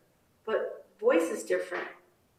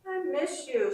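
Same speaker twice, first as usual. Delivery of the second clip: holding back tears; 7 semitones higher than usual. Acted. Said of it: "as if she's holding back tears".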